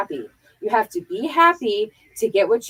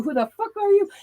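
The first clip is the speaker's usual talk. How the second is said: challenging stranger; 9 semitones higher than usual. Pretend. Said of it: "they ask you".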